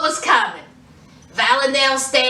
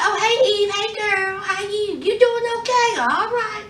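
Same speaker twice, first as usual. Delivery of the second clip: higher, chirpy, casual hello; lightly voiced airy friend saying hi; breezy girly greeting; 6 semitones higher than usual. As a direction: act unbothered and sweet. Valentine greeting Eve breezily.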